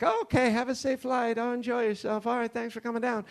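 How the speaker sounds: high, sweet and overly friendly; chirpy send-off to a departing traveler; polite Irish agent. Retold or imitated. Airport agent chirpily wishing travelers a safe flight.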